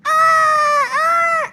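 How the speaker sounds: put-on high shouted cry of a fallen toddler; toddler wail. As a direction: wail like a small kid who just fell. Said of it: "Terus dia nangis".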